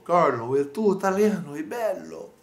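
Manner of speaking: imitating a friend's cheerful compliment; quoting Italian friends. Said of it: "mi hanno detto".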